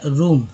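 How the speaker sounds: रूम with a long "oo"; pronunciation demo. Shown as a demonstration. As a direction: model रूम as a separate word. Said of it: "double ओ का उच्चारण ऊ के रूप में". (रूम में लंबा ऊ, साफ़ उच्चारण)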